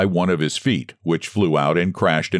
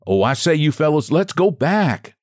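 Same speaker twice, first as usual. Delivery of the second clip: higher, pleading cry to turn back; whiny higher plea, slowing on go back; 7 semitones higher than usual. Acted. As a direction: beg the others to retreat, pitched up. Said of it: "cried he".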